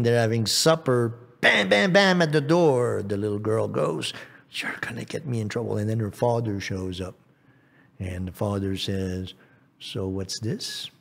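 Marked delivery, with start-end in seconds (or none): 1.4-2.2 high, quick-fire door bangs; high-pitched storyteller knocks; imitated banging. 4.5-5.8 fast, hushed whispered complaint; urgent whisper. Acted. Princess hisses at frog at door. 9.8-10.9 low, quiet, slow father; understated stern-dad question. Acted.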